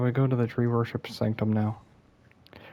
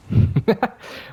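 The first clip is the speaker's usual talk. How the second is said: quick, higher-pitched chuckle; a brief laugh at your own expense; short laugh; 13 semitones higher than usual. Real, not acted. Player owning that it's his fault, short self-deprecating laugh. Laughs after blaming himself.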